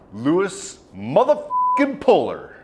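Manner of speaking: slow, drawn-out announcer hype; dramatic slow reveal, leaning into every word. Acted. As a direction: stretch the name out like a ring announcer introducing a champion. Hyped reveal of the hero.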